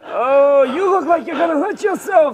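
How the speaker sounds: put-on tired, complaining old camel voice; grumpy old camel. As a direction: moan about aching back and feet. Camel voice continues.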